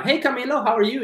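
high, bright "hey" to a friend; chirpy imagined greeting. Retold or imitated. Imagining greeting Camilo in English, high and bright. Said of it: "I'm gonna say".

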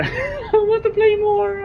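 high, slow strained stretching voice; stretching groan. Real, not acted. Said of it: "sorry, I'm stretching".

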